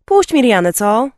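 loud, high, pushy request; demanding. A narrator's reading. Sister pestering brother for a song, loudly demanding. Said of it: "zażądała".

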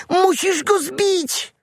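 very high slow insistent demand; pleading piglet. A narrator's reading. Piglet urging the fox to swat the mosquito, high insistence. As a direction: urge the fox desperately.

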